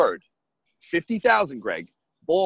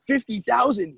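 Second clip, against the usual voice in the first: sharp, high and louder; a retold angry counteroffer; pissed retort; 8 semitones higher, 5 dB louder than usual. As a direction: throw the number back, offended. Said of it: "He was pissed".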